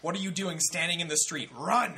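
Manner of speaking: rising to a shouted Run; inner voice yelling. Retold or imitated. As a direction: bark at yourself to run. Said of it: "the little voice in my brain was like".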